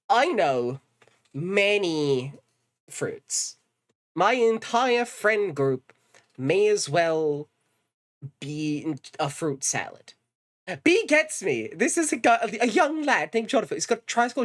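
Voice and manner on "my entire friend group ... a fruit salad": slow deadpan. Real, not acted. Streamer telling chat her friends are all fruits, slow and deadpan. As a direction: draw each phrase out for the joke.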